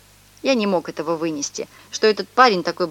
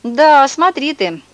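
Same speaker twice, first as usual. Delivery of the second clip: slow, drawn-out, louder put-on voice; sly street guy; 7 semitones higher, 7 dB louder, slower than usual. Stranger teases the boys, drawn out and loud. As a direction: drawl it like a swaggering stranger. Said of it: "Этот парень сказал".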